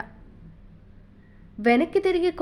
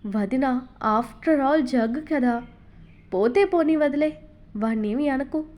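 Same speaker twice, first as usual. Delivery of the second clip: ordinary delivery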